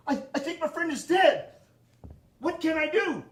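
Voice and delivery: hammed-up terrified caller; mock panic. Hunter calling the operator, in a hammed-up panic. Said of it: "in a panic".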